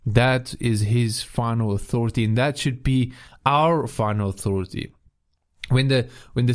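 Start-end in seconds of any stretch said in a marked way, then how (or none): none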